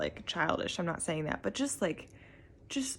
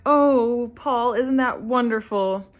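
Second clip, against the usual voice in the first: higher and louder, mock delighted; Julia's reaction; 6 semitones higher, 9 dB louder, slower than usual. Julia speaking to her husband Paul, mock delighted. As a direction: act out Julia forcing cheer. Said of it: "she's like".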